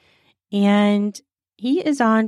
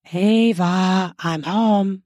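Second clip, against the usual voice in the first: calling out; much slower than usual. Acted. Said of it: "called out".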